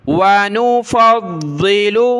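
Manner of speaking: slow, drawn-out chanted recitation; Quranic recitation. Shown as a demonstration. Teacher models the verse for the student, slow chant. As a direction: recite each word long and even.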